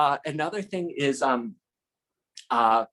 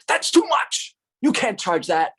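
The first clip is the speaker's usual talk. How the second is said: mock indignant; 10 semitones higher, 5 dB louder than usual. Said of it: "He's like".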